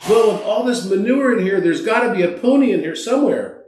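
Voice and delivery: put-on high, thrilled child's voice; overjoyed little boy. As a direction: squeal with delight like a five-year-old. Optimistic boy explains his joy.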